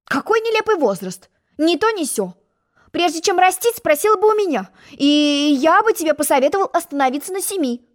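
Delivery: high, know-it-all advice; a condescending egg lecturing a girl. Acted. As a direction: advise her smugly. Humpty lecturing Alice on age.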